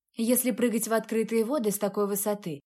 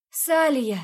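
loud, higher call across water; 5 semitones higher, 6 dB louder than usual. Acted. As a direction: call her name loudly. Calling for girl after surfacing.

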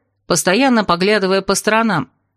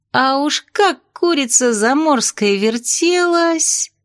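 slow, high, drawn-out relish; dreamy; 7 semitones higher, slower than usual. A narrator's reading. Princess relives the scene for friends, dreamily. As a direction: swoon over the memory, stretching the words. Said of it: "мечтательно закатила глаза".

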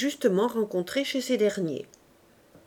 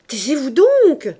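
loud, slow, higher scolding; shouted; 5 semitones higher, 9 dB louder than usual. A narrator's reading. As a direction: snap her silent. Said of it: "cria la sage-femme".

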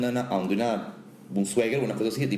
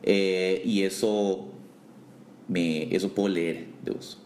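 ordinary talk, nothing marked

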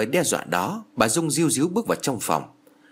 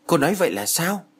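high, baffled question; lost and confused, pitch rising; bewildered; 6 semitones higher than usual. A narrator's reading. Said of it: "ngơ ngác".

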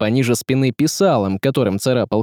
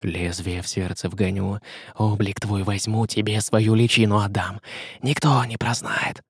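hushed, lowered threat; menacing hiss; 3 semitones lower, 6 dB quieter than usual. A narrator's reading. Казимир threatens to steal his shape, soft menacing hiss. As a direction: hiss a secret threat softly. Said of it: "зашипел Казимир ему в лицо".

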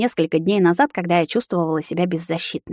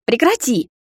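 high, sharp command; barked order; 6 semitones higher than usual. A narrator's reading. Vampire orders the girl to stop thawing, high urgent bark. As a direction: demand a stop in alarm. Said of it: "рявкнул высший".

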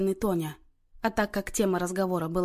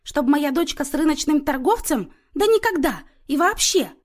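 loud, high-pitched outrage; an outraged mother tearing into someone; indignant attack; 7 semitones higher, 5 dB louder than usual. A narrator's reading. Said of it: "накинулась на неё Люся".

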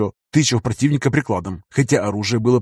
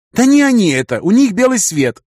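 insistent woman; 10 semitones higher, 5 dB louder than usual. A narrator's reading. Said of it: "доказывал женский голос".

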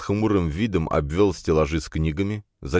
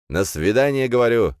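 mild shout, higher and louder; 6 semitones higher, 4 dB louder than usual. A narrator's reading. Friend repeats himself right into his ear, mild shout. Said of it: "прокричал он практически прямо мне в ухо".

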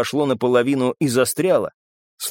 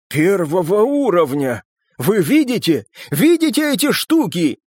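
rising, high-pitched astonishment; amazed excitement; 6 semitones higher, 3 dB louder than usual. A narrator's reading. Папаша asking if others see the symbols, excited. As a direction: climb higher with each question.

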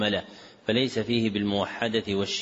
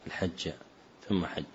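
low, soft, word-by-word model reading; careful corrective reading; 3 semitones lower, 4 dB quieter than usual. Shown as a demonstration. Teacher correcting the student's reading, careful and measured. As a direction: read it out in pieces, pausing before الحج.

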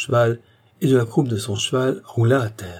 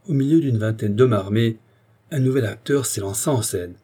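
ordinary delivery

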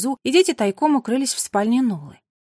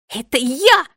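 loud, high indignant outburst; exploding; 4 semitones higher, 8 dB louder, slower than usual. A narrator's reading. Sister claims the find from her brother, exploding. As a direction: burst out angrily, raising the voice. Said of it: "взорвалась Нола".

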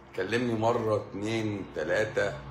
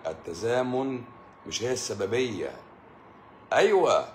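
normal talking voice

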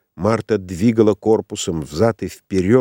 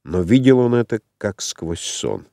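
ordinary delivery, nothing marked

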